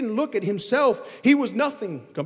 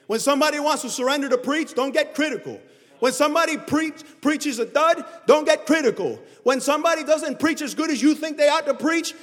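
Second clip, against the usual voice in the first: higher, louder preacher's call; preaching at full force; 6 semitones higher, 4 dB louder than usual. Acted. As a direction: drive it home to the congregation, high and loud.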